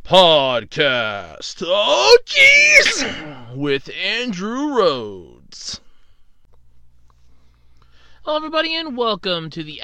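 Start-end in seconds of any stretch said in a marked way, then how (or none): 0.0-1.6 low booming announcer. Acted. Mock show intro announcer. 1.6-3.5 high startled shout; startled yelp. Acted. Host stumbling on the stairs. 3.5-5.8 announcer voice. Acted. Mock show intro announcer.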